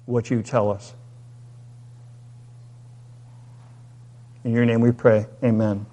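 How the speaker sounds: hushed congregational prayer. Acted. Leads a prayer.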